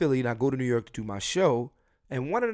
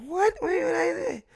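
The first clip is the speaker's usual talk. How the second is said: sky-high, startled "What? Wait, wait"; shocked disbelief. Retold or imitated. Retelling his mom calling him a thief, high shocked disbelief. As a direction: squeak it out, caught completely off guard.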